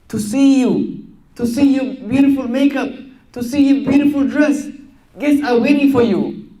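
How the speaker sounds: mother pressing her with guests and makeup; pleading mother. Retold or imitated. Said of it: "So her mother said".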